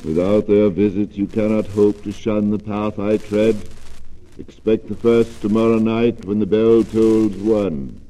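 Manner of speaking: grave ghost delivering a warning; ghostly warning. Acted.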